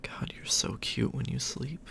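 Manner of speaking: usual speaking voice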